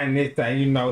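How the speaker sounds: ordinary talk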